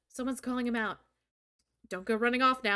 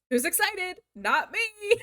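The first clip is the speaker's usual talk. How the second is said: sarcastic; 7 semitones higher, 7 dB louder, slower than usual. Real, not acted. Sarcastic cheer.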